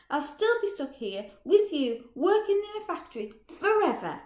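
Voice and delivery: high, loud, bitter yelling that climbs at the end; loud desperate fury at a dead-end life; furious shout. Acted. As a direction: rant it in rising rage. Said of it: "Roger shouts".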